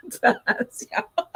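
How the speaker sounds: ordinary delivery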